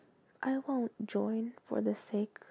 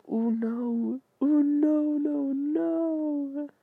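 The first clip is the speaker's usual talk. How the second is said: loud, high, long-held "no"; drawn-out dismay; 5 semitones higher, 8 dB louder, much slower than usual. Real, not acted. Reader dreads the next text, loud wailing dismay. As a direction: wail it out, stretching the last no.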